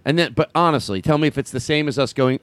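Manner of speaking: normal talking voice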